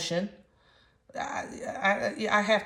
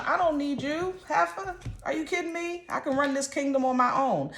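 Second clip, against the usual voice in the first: high, sassy king brushing off the queen; voicing the king; 7 semitones higher than usual. King dismissing his queen, sassy and high. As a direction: toss it off like a proud king who needs no one.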